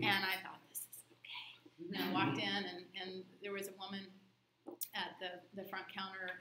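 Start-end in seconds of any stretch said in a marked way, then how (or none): 0.7-1.9 whisper. Retold or imitated. Tiptoeing into very quiet office.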